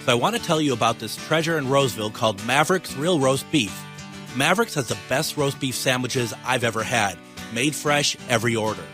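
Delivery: upbeat radio ad read. Acted. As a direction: pitch it like a friendly sponsor. Host reads sponsor ad.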